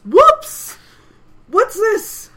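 high, very loud yelp of surprise; screamed. Acted. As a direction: scream it out, startled. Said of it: "He literally screams".

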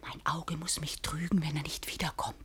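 fearful whisper. Acted. Maid whispers warning that master returns, whisper. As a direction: whisper so he won't hear.

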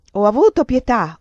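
forceful outraged cry; angry yell. A narrator's reading. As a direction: yell it back in reproach. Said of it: "urlò".

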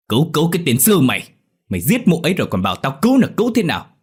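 fast, high, loud tirade; furious cursing. A narrator's reading. Father striking and rebuking his son, furious. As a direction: throw the plea back angrily.

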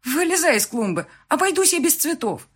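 high, loud order; calling out, exasperated. A narrator's reading. Bride ordering groom out of the flowerbed, exasperated.

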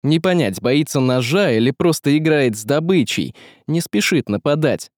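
usual speaking voice

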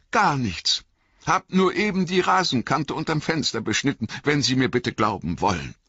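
high-pitched deferential Sam voice; high, eager-to-placate underling; Sam, servile gardener. Acted.

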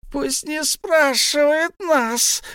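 very high, slow squeal; Gollum shrieking. A narrator's reading. Gollum squeals at Bilbo to mind his business. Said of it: "взвизгнул Голум".

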